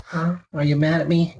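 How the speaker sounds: ordinary talk, nothing marked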